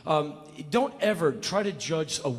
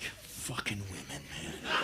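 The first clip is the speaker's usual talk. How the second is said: much lower and softer grumble; muttered aside; 13 semitones lower, 15 dB quieter, slower than usual. Retold or imitated. Husband grumbling over breakfast, much softer and lower. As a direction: mutter it into your plate. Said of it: "The guy goes back to his meal like".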